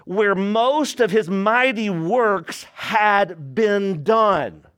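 slow, weighty scripture reading, word by word; read slowly. Shown as a demonstration. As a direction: let every word land slowly. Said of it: "Let me read this slowly".

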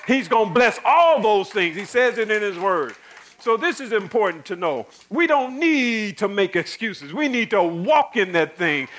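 fervent pulpit preaching; preaching at full force. Acted. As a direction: preach it out to the congregation.

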